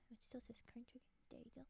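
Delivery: fast whispered aside; whispering. Real, not acted. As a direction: rush it under breath. Quick muttered aside.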